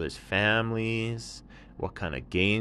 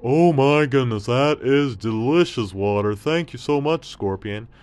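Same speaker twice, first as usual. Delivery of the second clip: high, loud delighted camel; loud, joyful camel seeing water; excited camel; 6 semitones higher, 10 dB louder than usual. Acted. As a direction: gasp at the sight with joy.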